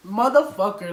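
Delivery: loud, high burst of outrage; angry outburst. Real, not acted. Storyteller reacting to the cop's jail threat, angry outburst. Furious at the retold police threat.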